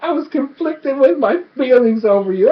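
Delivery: high, hammed-up whiny excuse; pleading lover. Jilting lover excusing himself to her, mock pleading. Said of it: "And he's like".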